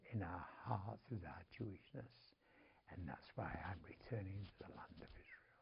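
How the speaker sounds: whisper. Retold or imitated. Woman's heartfelt final answer.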